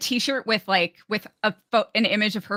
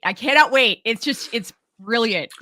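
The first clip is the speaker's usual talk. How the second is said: higher and louder, bursting with eagerness; excited; 3 semitones higher, 5 dB louder than usual. Real, not acted. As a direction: punch it out with glee. Repeats line, second more excited.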